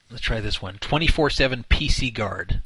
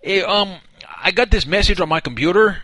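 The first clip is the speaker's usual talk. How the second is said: higher, louder put-on dim caller; clueless caller voice; 5 semitones higher, 4 dB louder than usual. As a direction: play a confused, flustered customer. Caller plays clueless victim to scammer.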